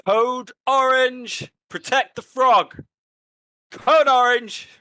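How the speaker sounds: high, slow shrieking alarm call; panicked shouting. Acted. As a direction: yell it as loud as you can. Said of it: "shout out as loud as they can get".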